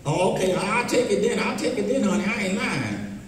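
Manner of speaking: usual speaking voice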